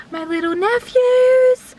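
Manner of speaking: high, slow and gushing; gushing cutesy voice. Real, not acted. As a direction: coo it like talking about babies. Excited about seeing nephews.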